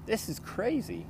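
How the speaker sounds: high, slow, dismayed female character voice; overwhelmed woman. A narrator's reading. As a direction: let it sink in, high and slow. Claire overwhelmed by the hiring list.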